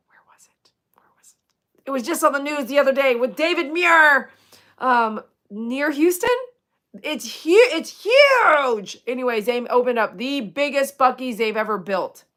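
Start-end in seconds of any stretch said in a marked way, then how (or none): 0.1-1.9 barely audible whisper; whispered to self. Real, not acted. Trying to recall where the store opened, whispered to self. 7.7-9.0 "huge" stretched out high and loud; emphatic HUGE. Real, not acted. Telling Joey about the biggest Buc-ee's, "huge" stretched high. Exclaims over biggest store.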